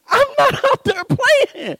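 high, slow whining objection; indignant protest. Acted. Husband accused of playing in the yard, whining protest.

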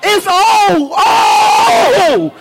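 O held long, high and swelling; emphatic O. Shown as a demonstration. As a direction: cry out each O with full force. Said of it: "it's more than one O".

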